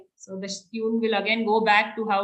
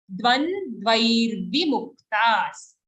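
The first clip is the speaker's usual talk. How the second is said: slow careful demo; much slower than usual. Shown as a demonstration.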